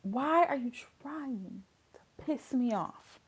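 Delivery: slow, hushed exasperation; quiet, drawn-out frustration; seething restraint. Real, not acted. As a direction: say it softly and slowly, barely holding it in. Spent, seething after the rant.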